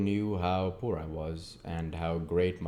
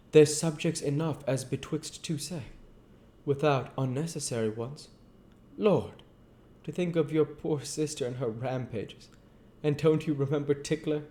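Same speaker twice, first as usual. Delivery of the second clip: higher-pitched Joe, earnest and homely; Joe's voice; 8 semitones higher than usual. Acted. Joe talking gently to old Pip, in a higher voice. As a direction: pitch up into Joe, a plain simple man. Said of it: "this is Joe's voice".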